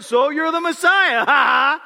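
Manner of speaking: slow, loud, sneering shout; mocking shout. Dying thief shouting a sneer at Jesus, mocking shout. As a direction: hurl the taunt across the crosses. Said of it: "he's like doing it".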